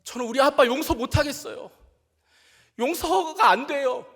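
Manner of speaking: high, loud anguished shout. Retold or imitated. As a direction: yell it out as a hurt teenager refusing to forgive. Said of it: "소리치면서".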